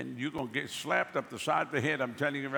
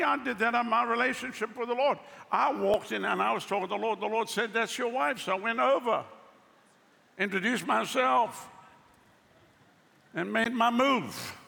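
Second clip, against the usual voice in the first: fiery preaching, high and loud; preaching at full force; 8 semitones higher, 4 dB louder than usual. Real, not acted. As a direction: preach it with rising fervor.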